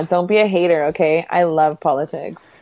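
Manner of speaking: usual speaking voice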